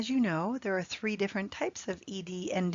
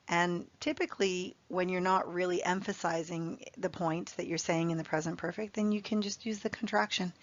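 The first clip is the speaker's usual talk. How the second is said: ordinary talk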